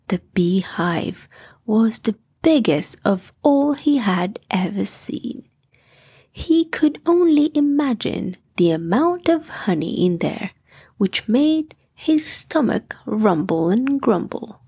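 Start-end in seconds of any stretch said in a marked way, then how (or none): none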